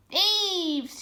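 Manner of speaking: high, loud shriek; shrieking rat. A narrator's reading. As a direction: screech it after them. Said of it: "shrieked the Rat".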